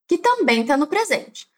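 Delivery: ordinary talk, nothing marked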